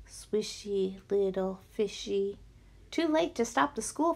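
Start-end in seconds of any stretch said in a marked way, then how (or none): 0.1-2.4 slow, soft, droning zombie chant; zombie mumbling. A narrator's reading. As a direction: mumble like a hypnotized crowd. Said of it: "all mumbling".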